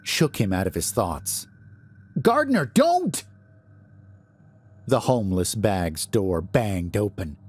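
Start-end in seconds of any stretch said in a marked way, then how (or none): 2.2-3.2 very high, drawn-out cry of alarm; near shriek. A narrator's reading. Cypher warns Gardner off the door, nearly shrieking. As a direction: cry out to stop him, voice shooting up. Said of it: "nearly a shriek".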